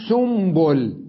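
سنبل said slowly as "sumbul"; nun turned to mim. Shown as a demonstration. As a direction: stretch the m in سنبل, replacing the n. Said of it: "تصبح سنبل".

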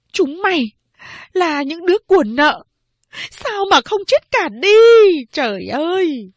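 high, loud cursing scream; cursing mother. A narrator's reading. Mother cursing her children after striking them. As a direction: curse them in despair. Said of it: "Rủa xong".

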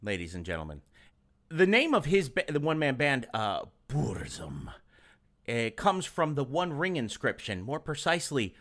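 very low, soft pronouncement; mock-solemn lore voice. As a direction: explain it deep and hushed like ancient lore.

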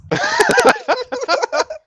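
very high shrieking laugh. Real, not acted. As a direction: break into a high howl of laughter. Big laugh at joke.

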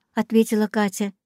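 ordinary delivery

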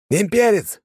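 loud sharp yell. A narrator's reading. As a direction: shout the alarm high. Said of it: "заорал Арамашир".

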